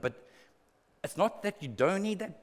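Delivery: ordinary talk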